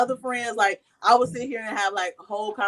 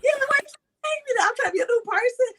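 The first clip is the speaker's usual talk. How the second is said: exasperated retort; faster than usual. Retold or imitated. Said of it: "Go ahead and hit me".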